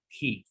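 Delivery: "He" said as "ji"; H as Spanish J. Shown as a demonstration. Teacher answers how he is pronounced, "He" as "ji". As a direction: say "He" with a Spanish J and an "ee" vowel. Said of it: "La H se pronuncia como una J".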